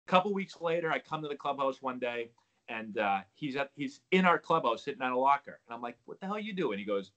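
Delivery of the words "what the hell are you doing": shocked, high-pitched disbelief; surprised. Retold or imitated. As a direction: blurt it out in shock. Surprised teammate in clubhouse.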